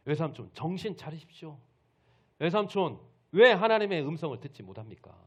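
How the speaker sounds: loud, high angry shouting; furious rebuke. Acted. Jacob berates his uncle for ignoring God, furious rebuke. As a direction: thunder at him to wake up. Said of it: "이제 엄청나게 화를 내면서".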